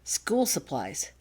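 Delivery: usual speaking voice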